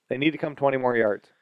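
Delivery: ordinary delivery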